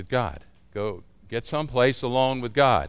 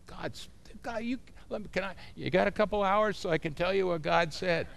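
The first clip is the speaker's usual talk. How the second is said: breathless excitement; 6 semitones higher, 4 dB quieter than usual. Retold or imitated. Pastor gushing about mountain retreat.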